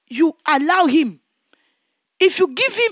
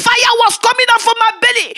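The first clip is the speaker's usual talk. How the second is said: normal talking voice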